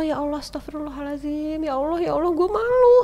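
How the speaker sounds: ashamed wife crying out in public; distressed pleading. Retold or imitated. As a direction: plead to God, mortified. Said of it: "Terus aku".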